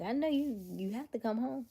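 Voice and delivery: normal talking voice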